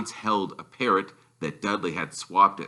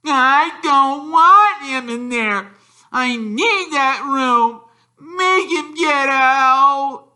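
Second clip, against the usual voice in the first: much higher, loud, slow whiny wail; bawling; 8 dB louder, much slower than usual. Acted. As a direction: howl like a spoiled brat. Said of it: "Dudley bawling at his mother".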